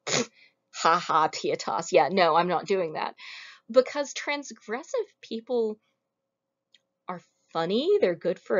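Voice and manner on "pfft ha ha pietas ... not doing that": scoffing with a mock laugh; dismissive scoff. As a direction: brush it off with a mocking pfft. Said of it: "somebody who's like".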